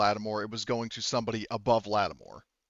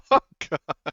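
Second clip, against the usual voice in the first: laughing groan; 14 semitones higher, 8 dB louder than usual. Real, not acted. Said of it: "reacting to the grim line".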